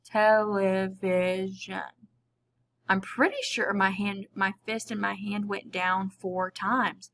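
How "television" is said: Television drawn out slowly, each syllable separate. Shown as a demonstration. Teacher demonstrating to the class, saying the word slowly. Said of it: "say it slowly in order to be able".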